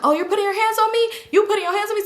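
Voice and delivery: high indignant disbelief; mock outrage. Drunk girl outraged that Leslie pushed her, high indignant. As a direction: act shocked at being touched.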